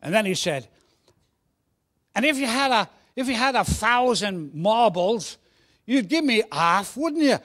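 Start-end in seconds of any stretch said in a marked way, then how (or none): 2.2-7.4 put-on high, loud Cockney boy; Cockney accent. Cockney boy pressing his pal about marbles, loud high Cockney. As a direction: push harder in Cockney. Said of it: "Should I put on a Cockney accent".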